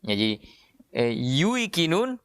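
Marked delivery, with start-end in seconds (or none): 1.1-2.2 yuikinun said slowly, yu and i apart; slow original form. Shown as a demonstration. Teacher demonstrates original yuikinun, slow and rising. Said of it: "diganti dengan huruf mat".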